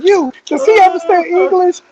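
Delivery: high imitated demand; exasperated sergeant. Sergeant questioning the recruit behind, high and exasperated. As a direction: turn on the next recruit, exasperated.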